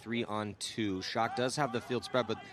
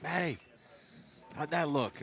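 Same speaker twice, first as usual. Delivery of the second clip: put-on New York accent; 5 semitones higher than usual. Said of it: "very heavy New York accent".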